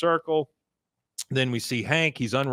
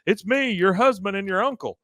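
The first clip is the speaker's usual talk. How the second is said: calling out; 8 semitones higher than usual. Retold or imitated. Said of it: "He goes".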